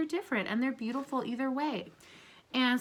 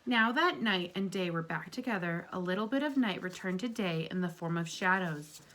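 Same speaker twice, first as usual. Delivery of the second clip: gentle read-aloud to a class; storybook reading; 2 semitones lower than usual. Acted. Teacher reading a story aloud to her class, gently. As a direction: read it like a picture book for kids. Reads picture book to class.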